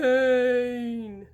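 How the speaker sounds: a low drawn-out ghost moan on the name; deep ghostly moan. A narrator's reading. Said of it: "Sabrina moaned in the deepest voice she could produce".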